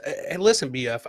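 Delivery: ordinary delivery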